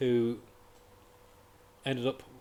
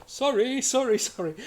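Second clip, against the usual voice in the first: high, loud sheepish sorry while mowing them down; mock apology; 12 semitones higher, 8 dB louder than usual. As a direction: play a pilot apologising in a panic, pitched up. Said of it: "he's kinda, like, going".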